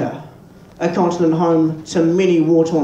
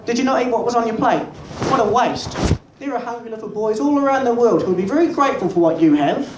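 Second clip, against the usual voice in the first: nagging Nana; 5 semitones higher than usual. Retold or imitated. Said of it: "Nana would come along and go".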